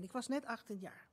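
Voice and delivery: ordinary delivery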